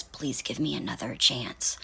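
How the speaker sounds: breathy whispered plea, imitating a creep; hushed creepy begging whisper. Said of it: "Like, all creepy".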